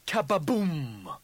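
high, slow kababoom; drawn-out mock explosion. A narrator's reading. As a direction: stretch it into a bigger blast. Bigger exclaimed explosion sound.

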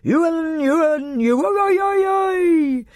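high, whooping yodelled calls; yodelling cries. A narrator's reading. Feasting revellers cry out with mouths full, yodelling. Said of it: "the yodeling cries of".

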